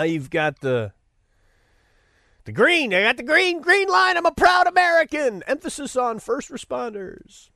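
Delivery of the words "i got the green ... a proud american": high-pitched parody of a proud patriot; mock proud boasting. Green-line flag owner showing off, mock proud.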